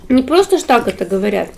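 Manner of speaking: ordinary talk, nothing marked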